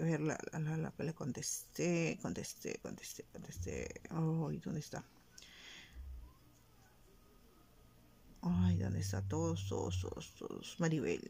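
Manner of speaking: barely voiced mutter while searching; muttering to self. Real, not acted. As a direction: mumble under your breath, hunting for a message. (murmullo casi susurrado mientras busca un mensaje)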